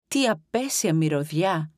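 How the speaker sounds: plain reading voice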